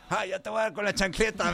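high, playful child voice imitating dad; mock child threat. A daughter playing dad threatens him with the slipper, high and mock. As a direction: squeak out a pretend threat. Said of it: "Y me dice".

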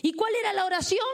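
ordinary talk

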